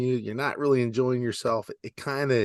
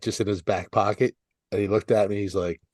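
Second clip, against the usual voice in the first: ordinary delivery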